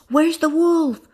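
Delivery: high, urgent called question; crying out. Acted. Farmer arriving to fight the wolf, crying out. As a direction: cry out in alarm. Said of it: "cried another".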